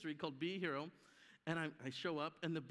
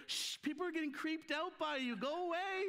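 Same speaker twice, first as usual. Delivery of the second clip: higher hushed mock scolding of the wife; hammed-up wife shushing her clingy husband; wife shooing him off; 9 semitones higher than usual. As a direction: imitate the wife telling him to get lost. Wife shooing him away retold.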